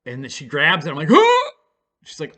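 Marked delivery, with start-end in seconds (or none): 1.1-1.5 sharp, much higher startled yelp; startled huh. Retold or imitated. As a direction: jump at the sudden pain. She grabs his arm.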